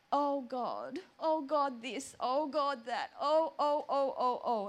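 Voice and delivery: imitating a string of moaning complaints; mock complaining. Confessing how she prayed, mock complaining. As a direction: whinge each item, piling up the ohs. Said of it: "I do enter his presence with a complaint".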